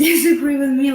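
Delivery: giggling at the very idea; laughing. Real, not acted. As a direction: tease them through a laugh. Jokingly challenging disagreeing viewers.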